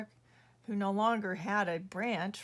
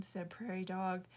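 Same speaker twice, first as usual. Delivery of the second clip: ordinary delivery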